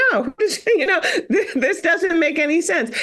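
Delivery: laughing retort. Retold or imitated. Retelling her clinic clash, laughing in disbelief.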